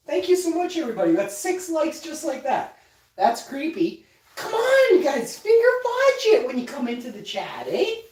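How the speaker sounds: high, loud hype voice greeting the chat; streamer shout-outs. Real, not acted. Streamer hyping up viewers in a high, loud voice. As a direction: pump up the chat and beg for likes.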